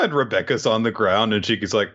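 usual speaking voice

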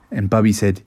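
ordinary delivery

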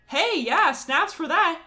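mock enthusiasm. Reacting to the essential oil guy's testimony, in mock cheerful praise. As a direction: hype him up with fake delight.

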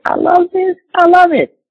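delighted. Real, not acted. Preacher telling the church how he loves the healing verse, excitedly. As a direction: gush over the verse with joy.

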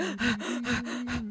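a quick, startled in-breath; sharp gasp of relief. Retold or imitated. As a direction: gasp like you just escaped danger. Relief on reaching grocery store.

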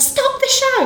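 put-on high, urgent Essex accent call. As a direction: yell for help in broad Essex. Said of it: "my Essex accent".

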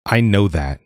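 a mild irritated snap; short, testy retort, understated; snapped. Acted. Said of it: "Ada snapped".

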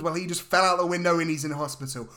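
ordinary talk, nothing marked

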